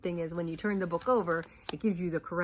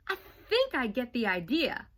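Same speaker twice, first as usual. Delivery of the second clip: exasperated, rising louder; a kid fed up with his story; frustrated boy; 8 semitones higher, 6 dB louder than usual. Acted.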